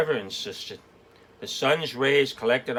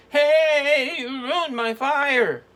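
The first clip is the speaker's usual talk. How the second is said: slow, very high drawn-out whine; stretched out; 6 dB louder, much slower than usual. Acted. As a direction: drag the words out in protest. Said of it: "stretched Trevor".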